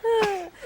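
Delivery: squeaky helpless giggle; high-pitched giggle. Real, not acted. Weatherman giggling on air.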